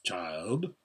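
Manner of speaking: low, soft, gently checking; grave great-being address to a child; large voice. A narrator's reading. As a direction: say it deep and quiet, with authority. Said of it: "said the voice".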